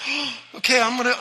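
usual speaking voice

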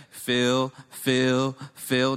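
low, loud, drawn-out calls; praying minister. Retold or imitated. Minister praying down the line, loud intoning. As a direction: imitate a minister calling it over each person.

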